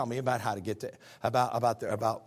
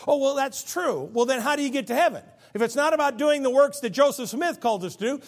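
high, loud pulpit voice; loud preaching; 7 semitones higher, 7 dB louder than usual. Acted. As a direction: hammer the verse home to the congregation. Retold debate, preached loud.

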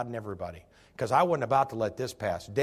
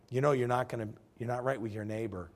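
usual speaking voice

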